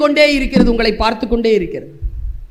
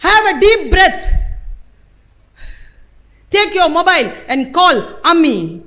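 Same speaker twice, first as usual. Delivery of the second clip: very slow, higher and louder stern command; stern device voice; 5 semitones higher, 4 dB louder, much slower than usual. Retold or imitated. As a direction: order them to sit, slowly. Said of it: "அவ்வளவு கடுமையா பேசுறா". (மிக மெதுவான கடுமையான கட்டளைக் குரல்)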